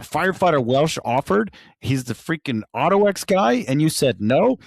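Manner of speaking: retold furious captain; angry captain. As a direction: rip into him as the embarrassed captain. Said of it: "And he's like".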